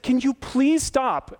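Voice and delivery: high, drawn-out exasperated plea; hurt pleading. Retold or imitated. Pastor asks the accuser to stop, pleading. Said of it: "I'm getting louder and more hurt by this".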